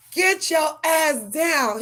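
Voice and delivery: loud, high and slow, word by word; slow shouted order. Retold or imitated. Owner ordering cat out of tree, slow loud shout.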